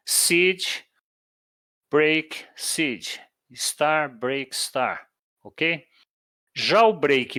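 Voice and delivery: palavra, break, palavra repetida com pausas; demonstração de rádio. Shown as a demonstration. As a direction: dita como numa fonia ruim, separando cada palavra. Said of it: "words twice". (palavras repetidas com pausas, como no rádio)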